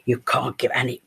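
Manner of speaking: low urgent whisper, almost no voice; intense whisper. Retold or imitated. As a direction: whisper it like your life depends on this part. Actress pleading for the part.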